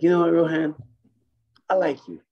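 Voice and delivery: a boss warmly giving his approval; Kenny voice. Retold or imitated. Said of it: "That sounds like Kenny".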